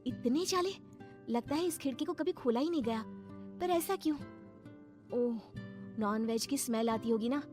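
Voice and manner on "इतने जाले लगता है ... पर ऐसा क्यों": high, wondering bahu voice; puzzled young wife. Acted. Priya finding cobwebs on the unopened window, puzzled.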